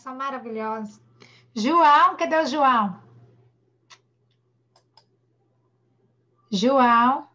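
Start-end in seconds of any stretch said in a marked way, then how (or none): 1.5-7.3 loud call for a missing pupil; teacher calling a pupil loudly. Real, not acted. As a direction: call the name out loud, searching. Calling a pupil in online class.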